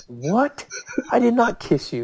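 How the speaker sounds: high-pitched incredulous protest; shocked denial. Retold or imitated. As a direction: replay being falsely accused, voice leaping up. Retold denial of the accusation.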